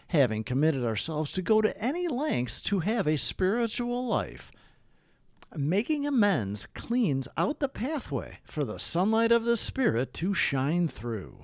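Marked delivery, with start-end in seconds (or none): none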